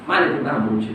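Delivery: ordinary talk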